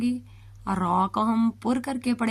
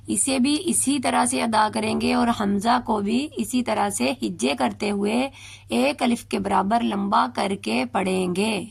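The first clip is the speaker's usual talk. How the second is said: ordinary talk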